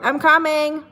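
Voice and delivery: loud high call to someone off. Real, not acted. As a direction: call out I'm coming. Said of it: "Not coming".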